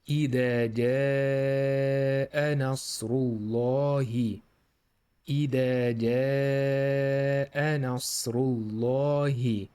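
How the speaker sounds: measured chanted Quranic recitation. Shown as a demonstration. Teacher recites the verse.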